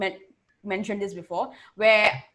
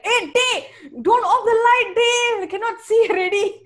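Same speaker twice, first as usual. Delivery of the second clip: high, loud mock Indian accent, sing-song; 10 semitones higher, 6 dB louder than usual. Mocking the guy who switches accent, high put-on Indian accent. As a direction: ham up a patronizing Indian accent.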